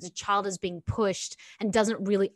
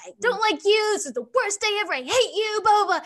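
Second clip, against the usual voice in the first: put-on much higher, louder wailing little girl; child's tantrum; 13 semitones higher, 6 dB louder than usual. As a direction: whine it like a kid melting down. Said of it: "She's like".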